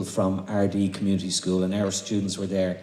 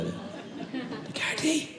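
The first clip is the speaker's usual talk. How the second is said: put-on child's voice, laughing; 8 dB quieter, slower than usual.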